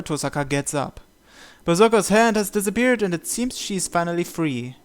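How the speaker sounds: ordinary talk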